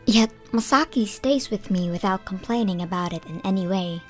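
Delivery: usual speaking voice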